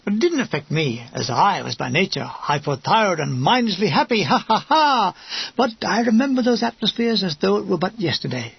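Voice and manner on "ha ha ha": higher, hammed-up ha-ha-ha; mock mindless laugh. A narrator's reading. Narrator showing his mindlessly happy nature, mock laugh. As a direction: laugh like a cheerful idiot, put on.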